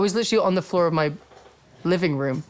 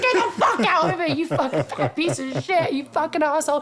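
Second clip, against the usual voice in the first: retold yell; 9 semitones higher than usual. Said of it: "I was like".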